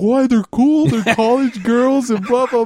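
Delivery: high, drawn-out mock protest; put-on high, insistent buddy voice; mimicked protesting buddy. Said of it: "He's like".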